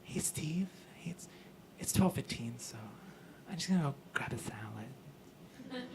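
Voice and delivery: hushed, whispery aside to a coworker; Salad Cindy. Acted. Boss telling Steve she's stepping out, whispered. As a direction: whisper it discreetly, very soft. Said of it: "I called her Salad Cindy".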